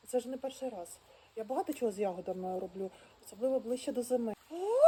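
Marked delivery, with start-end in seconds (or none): 4.5-4.9 short high squeal of joy; delighted squeal. Real, not acted. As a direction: let out a quick squeal of delight. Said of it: "Ти не верещи". (короткий захоплений вереск)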